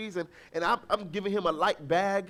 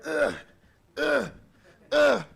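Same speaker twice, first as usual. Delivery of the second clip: mock straining grunts; 5 semitones higher, 5 dB louder, slower than usual. Father imitating his son straining to lift a bag. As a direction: imitate a toddler's effortful grunts. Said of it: "he's like".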